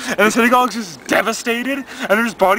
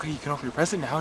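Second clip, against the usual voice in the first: much softer, subdued offer; quiet, sober dad; 3 semitones lower, 10 dB quieter, much faster than usual. Acted. Dad not yet drunk offers the present, quietly. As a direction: say it low-key and hushed. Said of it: "he's acting all quiet".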